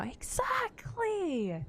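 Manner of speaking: very high, long drawn-out squeal; emphatic agreement. Real, not acted. Streamer agreeing wholeheartedly with a viewer's comment, squealed agreement.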